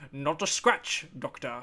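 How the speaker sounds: a hint of a Sean Connery voice; mock villain line in a lightly Scottish tone; Sean Connery-ish. Host quotes the Red Skull line, sounding like Sean Connery. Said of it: "I'm sounding like Sean Connery".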